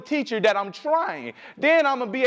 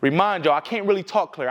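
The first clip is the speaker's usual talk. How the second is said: ordinary delivery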